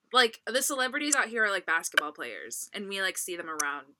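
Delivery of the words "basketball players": usual speaking voice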